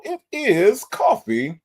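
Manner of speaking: high, slow, stretched name call; drawn-out announcement. Real, not acted. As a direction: draw the name out for effect.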